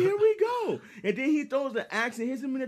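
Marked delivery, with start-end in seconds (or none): 0.0-0.8 much higher, louder, excited; hyped. Real, not acted. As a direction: jump up with excitement. Hyped about Thor's entrance.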